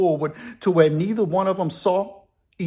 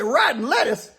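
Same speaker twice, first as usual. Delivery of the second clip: pitched up in disbelief; incredulous echo; 6 semitones higher than usual. Retold or imitated. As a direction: repeat it like you can't believe it. Al can't believe the answer.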